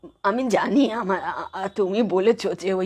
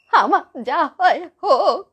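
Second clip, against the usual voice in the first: high-pitched wail building to sobs; crying plea; 11 semitones higher, 4 dB louder than usual. Acted. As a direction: break down as you plead. Grandmother breaks down crying.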